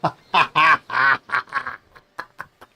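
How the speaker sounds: loud burst of real laughter; real, loud belly laugh; hearty laugh. Real, not acted. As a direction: laugh out loud in a long run. Amused reaction while playing.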